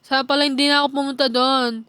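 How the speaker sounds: normal talking voice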